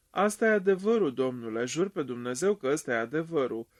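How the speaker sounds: ordinary talk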